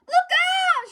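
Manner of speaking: high, loud shout. A narrator's reading. Lisa shouting as the road slips. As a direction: yell the warning in alarm. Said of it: "shouted Lisa".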